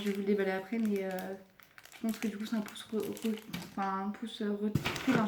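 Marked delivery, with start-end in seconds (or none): 1.9-5.3 halting false starts on a word; stumbling over words. Real, not acted. Vlogger hunting for the product's name, stumbling over words. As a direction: keep tripping on the word and restarting. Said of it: "j'ai du mal à parler aujourd'hui".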